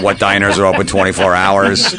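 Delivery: ordinary talk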